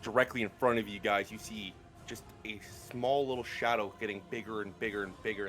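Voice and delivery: ordinary talk, nothing marked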